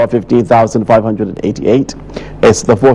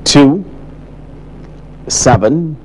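raised, slow calling of each drawn number; draw announcer; 5 semitones higher, 3 dB quieter, slower than usual. Acted. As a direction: call each ball out like a live draw. Live lottery number draw calling.

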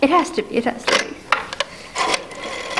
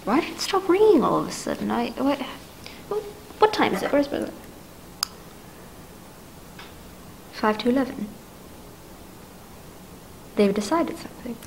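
much softer, breath held; frightened hush; 3 semitones lower, 10 dB quieter than usual. Acted. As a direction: speak low as if someone might hear. Woman alone fears hidden danger.

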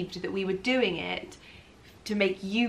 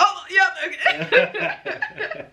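high, drawn-out startled reply, half laughing; snapped back to attention; 11 semitones higher, 5 dB louder, much slower than usual. Retold or imitated. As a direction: imitate someone jolted out of a daze. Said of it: "it snaps him back in".

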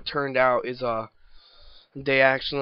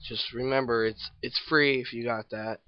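usual speaking voice

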